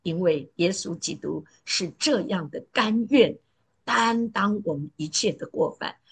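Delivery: ordinary delivery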